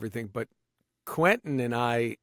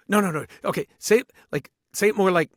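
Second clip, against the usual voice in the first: mildly insistent correction, pitched up and louder; directing an actor; 6 semitones higher, 6 dB louder than usual. Retold or imitated. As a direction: cut in with eager director's notes. Acting out director correcting his take.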